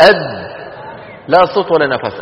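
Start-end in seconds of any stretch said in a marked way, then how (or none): 0.0-0.5 أد with the dal fully closed, no breath after; held dal demo. Shown as a demonstration. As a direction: stop hard on the dal of أد. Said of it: "الدال". (أد بدال شديدة محبوسة)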